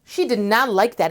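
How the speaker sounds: usual speaking voice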